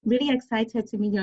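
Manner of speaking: ordinary talk, nothing marked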